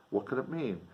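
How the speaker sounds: usual speaking voice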